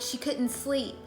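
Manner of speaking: ordinary delivery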